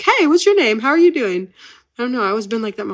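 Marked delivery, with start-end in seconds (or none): 0.0-1.5 high, bubbly hello to a stranger; imitating her school self greeting classmates; chirpy greeting. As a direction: greet the new kid brightly. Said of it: "I was always like".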